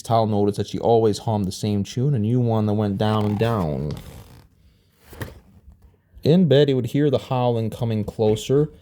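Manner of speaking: usual speaking voice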